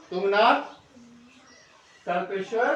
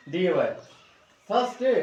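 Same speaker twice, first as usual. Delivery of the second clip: ordinary talk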